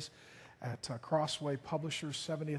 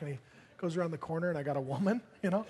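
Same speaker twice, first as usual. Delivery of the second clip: ordinary talk, nothing marked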